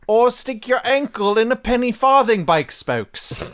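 loud, high, hammed-up posh English accent. Mocking the English phrase, in a loud, hammed-up English accent. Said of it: "in England town".

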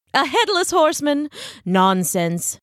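high pompous disbelief, dropping to dismissal; incredulous scoff. Acted. Ichabod dismisses Brahm's story, incredulous and pompous. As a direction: scoff at a silly tale.

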